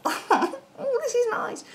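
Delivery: very high, soft contented sigh; dreamy high sigh. Retold or imitated. Reader voices the happy client, soft high cooing. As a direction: coo it up high, blissed out. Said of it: "And you're".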